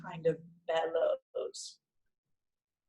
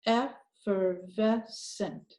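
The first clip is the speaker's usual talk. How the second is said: Effervescent stretched very slowly; slow blend; much slower than usual. Shown as a demonstration. Teacher blending the whole word, very slow. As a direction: draw out every chunk of Effervescent. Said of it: "look at the last one".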